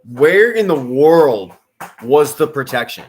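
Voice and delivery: loud, slow, drawn-out indignant question; exasperated disbelief. Real, not acted. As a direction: demand an answer, stretching the words out. Reacting to a bad play.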